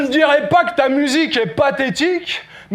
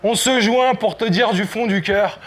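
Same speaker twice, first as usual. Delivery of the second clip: ordinary delivery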